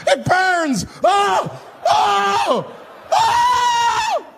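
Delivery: hammed-up man howling in pain; pained screaming. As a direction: scream like it's burning.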